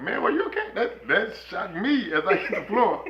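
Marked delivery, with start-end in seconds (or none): 0.0-0.7 mock concern.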